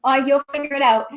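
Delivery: usual speaking voice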